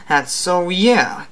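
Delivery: normal talking voice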